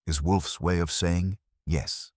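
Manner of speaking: ordinary delivery, nothing marked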